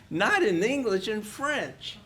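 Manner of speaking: a hint of an entitled customer correcting him; pushy tourist. Tourist snaps back at narrator.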